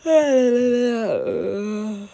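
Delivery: heavy exaggerated sigh; big loud sigh out. Real, not acted.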